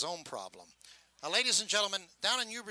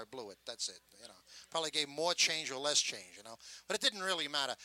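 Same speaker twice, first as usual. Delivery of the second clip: ordinary delivery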